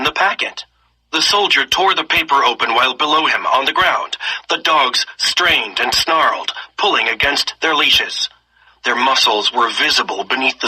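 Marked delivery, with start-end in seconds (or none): none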